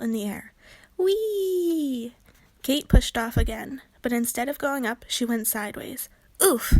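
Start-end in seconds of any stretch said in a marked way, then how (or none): 1.0-2.1 high, playful squeal of fun; gleeful whee. Acted. Gabby turning a somersault, squealing whee. 6.4-6.7 loud winded oof; grunt of impact. Acted. Kate hitting a tree trunk, grunting oof. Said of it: "she grunted".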